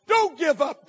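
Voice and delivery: loud exhortation; preaching shout. Acted. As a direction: urge them not to quit. Repeated exhortation.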